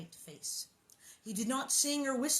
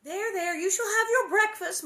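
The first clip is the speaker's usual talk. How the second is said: high, louder comforting; high soothing Ma; 10 semitones higher, 8 dB louder than usual. A narrator's reading. Ma soothing hungry little Carrie, high and gentle. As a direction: soothe the child. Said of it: "Ma said".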